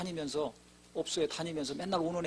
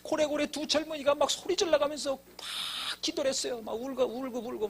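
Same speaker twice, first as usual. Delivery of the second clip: impassioned preaching; 8 semitones higher than usual. Acted. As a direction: pour it out like a revival preacher at full cry. Continues fervent preaching. (높고 큰 소리로 울먹이며 열정적으로 설교하듯)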